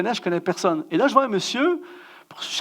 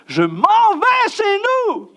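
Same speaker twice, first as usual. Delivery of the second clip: high, very drawn-out mock shout; desperate comic wail; 12 semitones higher, 4 dB louder, much slower than usual. Guest on an awful mattress giving up, desperate comic wail. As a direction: howl it slowly, laughing at yourself. Climactic outburst on the filthy mattress.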